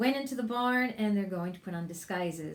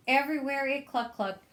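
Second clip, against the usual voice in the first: plain reading voice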